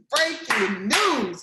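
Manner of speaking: loud, high mock news-flash announcement; playful loud news-alert shout; breaking news call. Repeats the announcement for delegates.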